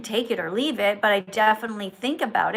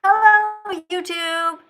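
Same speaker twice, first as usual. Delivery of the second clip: loud, high, drawn-out hello; higher-pitched, showy host's welcome; enthusiastic greeting; 6 semitones higher, 6 dB louder, much slower than usual. Shown as a demonstration. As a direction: greet the audience with big enthusiasm. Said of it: "with some enthusiasm".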